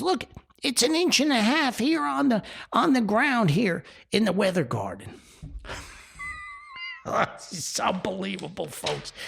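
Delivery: high, overexcited weatherman mimicry; dramatic TV weatherman. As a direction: play the weather guy marvelling at an inch of snow. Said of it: "he's out there real dramatic".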